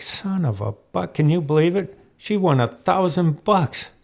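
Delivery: a buddy who can't believe she won; amazed friend. Retold or imitated.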